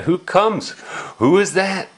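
usual speaking voice

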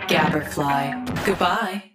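ordinary talk, nothing marked

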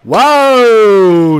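long, high, loud whoa of relief; excited whoa. Real, not acted. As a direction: shout it out at the strikeout. Strikeout after a rough inning.

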